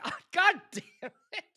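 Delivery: high comic curse with a laugh; mock dismay. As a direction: relive the flop, laughing at yourself. Retells dismay as bride walks off.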